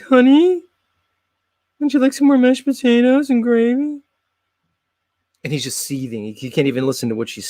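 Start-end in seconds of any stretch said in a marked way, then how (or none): 0.0-4.0 much higher, sugary housewife voice; sweet wife. Retold or imitated. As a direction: play the doting wife offering seconds. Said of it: "His wife's like".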